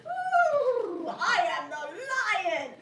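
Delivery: storybook lion. Acted. Puppeteer playing the sleeping lion for a captive audience, theatrical lion voice. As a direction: play a puppet-show lion. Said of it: "a lion was sleeping soundly in the forest".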